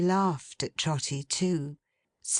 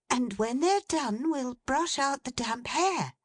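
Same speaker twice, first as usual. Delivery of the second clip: put-on much higher, light young woman's voice; Meg, young woman; 8 semitones higher than usual. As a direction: raise the pitch to play a fond daughter.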